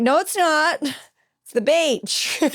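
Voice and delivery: high, drawn-out mock protest; stubborn denial. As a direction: insist stubbornly, stretching out the last words slowly.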